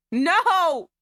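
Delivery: high, loud "No!"; startled shout; loud outcry. Real, not acted. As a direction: yelp it in protest. Reacting to the scene.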